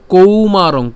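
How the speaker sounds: কৌমারং said loud, with a full open au in কৌ; stressed au sound. Shown as a demonstration. Teacher showing students the au sound, loud and clear. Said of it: "কৌ কেন? এখানে ঔকার আছে".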